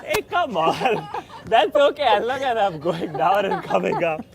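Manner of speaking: strained laugh mid push-up; laughing while straining. Real, not acted. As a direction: laugh through the effort.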